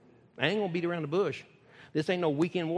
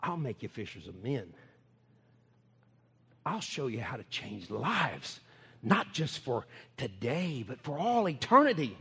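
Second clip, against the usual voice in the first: gentle Jesus voice; 8 semitones lower than usual. Acted. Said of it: "He said".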